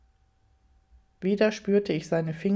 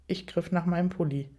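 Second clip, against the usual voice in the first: usual speaking voice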